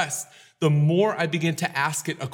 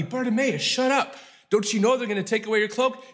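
high, sharp shut up from the crowd; angry crowd; 6 semitones higher than usual. Acted. Crowd telling beggar shut up.

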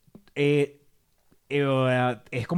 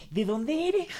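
surprised Mexican listener; 11 semitones higher, 5 dB quieter, much slower than usual. Retold or imitated. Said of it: "lo hice demasiado mexicano".

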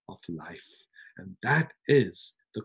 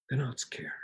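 whisper; 9 dB quieter than usual. Real, not acted. Preacher drops to emphatic hush.

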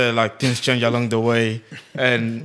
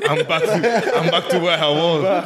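laughing through the words; half-laughing it out; 4 semitones higher than usual. Real, not acted. Friend laughs while telling friends about hunger on the walk.

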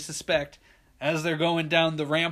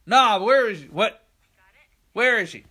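higher, raised voice; imitated anxious searching; 6 semitones higher, 7 dB louder than usual. Searching for the missing dog, raised urgent call. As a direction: call out as the worry starts.

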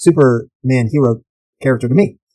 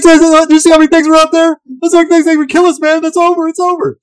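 much higher, frantic voice of a terrified marine; panicked soldier. Retold or imitated. Host quotes the spooked marine from Aliens, high panicked squeal. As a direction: squeal it like a soldier losing his nerve.

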